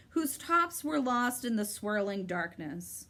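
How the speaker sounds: ordinary delivery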